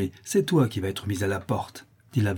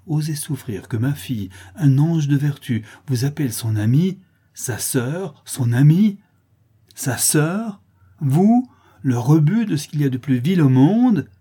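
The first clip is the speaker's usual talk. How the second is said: high, rising, incredulous outrage; outraged denunciation; 6 semitones higher, 7 dB louder than usual. A narrator's reading. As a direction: hurl each word as an insult. Mother denounces the girl. (indignation outrée)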